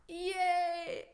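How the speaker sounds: high happy yay; cheering. Real, not acted. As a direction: cheer at your own success. Cheering after tongue twister.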